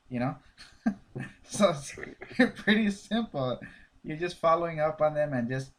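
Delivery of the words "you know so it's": ordinary delivery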